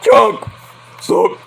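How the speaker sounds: loud, high mock holler; voice-straining yell. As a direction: keep bellowing like the movie character. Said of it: "I'm gonna lose my voice doing that".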